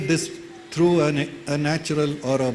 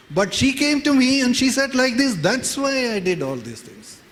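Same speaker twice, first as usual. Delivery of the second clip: higher-pitched, mildly acted protest; protesting self-justification; 7 semitones higher than usual. Husband excusing himself to the neighbors, mock protest. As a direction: plead your case, pitch lifted.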